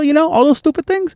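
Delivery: high put-on voice; mocking voice. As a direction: sneer at her words, way up high. Mocking friend's words.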